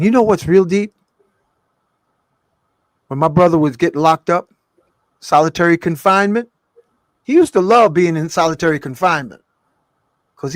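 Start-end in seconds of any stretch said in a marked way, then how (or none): 0.0-9.4 excited. Real, not acted. Excited emphatic storytelling.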